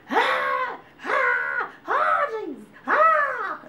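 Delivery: very high hammed-up labor wailing; mock labor screams. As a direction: wail like a woman in labor, overdone. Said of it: "expecting to hear somebody going".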